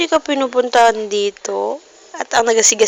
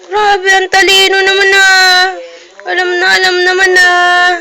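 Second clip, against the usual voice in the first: higher, louder mock-excited praise; 6 semitones higher, 5 dB louder than usual. Parent gushing over toddler's cleverness, higher and louder. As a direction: gush over the child's cleverness.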